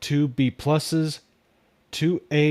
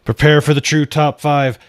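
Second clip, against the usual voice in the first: hype announcement; 2 semitones higher, 10 dB louder than usual. Acted. Announcing his top five.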